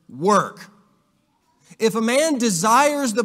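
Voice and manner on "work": "work" set apart after a pause and said heavily; emphasized word. Shown as a demonstration. Teacher to class, stressing the word "work". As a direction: land hard on "work" by itself. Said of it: "I am emphasizing the word".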